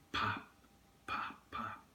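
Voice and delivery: hushed, nearly whispered pops; soft firework pops. Retold or imitated. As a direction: imitate faraway fireworks, fading to a whisper. Distant fireworks imitated in memory.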